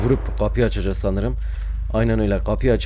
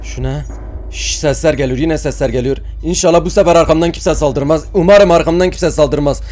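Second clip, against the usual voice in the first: ordinary delivery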